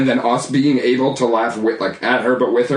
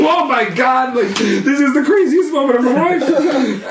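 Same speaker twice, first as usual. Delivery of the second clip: higher, laughing disbelief; stunned teen on a ride with stoned mom, laughing and higher; excited amazement; 11 semitones higher than usual. Retold or imitated. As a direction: gush through a laugh. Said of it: "I was like".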